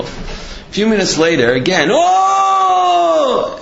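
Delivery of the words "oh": very high, louder hammed-up groan; loud mock groan. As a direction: wail it out from the heart. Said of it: "He's crying".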